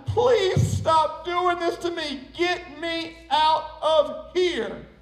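high, slow, drawn-out begging; hammed-up desperate cry; screamed plea. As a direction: plead and yell for release, each word stretched. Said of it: "I screamed, I yelled, I pleaded, I cried".

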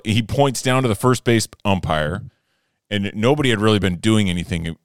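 ordinary delivery

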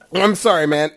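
coughing, water gone down wrong; choking on water. Real, not acted. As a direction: apologise while choking on water. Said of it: "I'm choking on the water".

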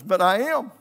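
put-on loud, high retort of a frightened boy; scared protest. Scared son answers his dad's order, loud high protest. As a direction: imitate a child blurting back in fear.

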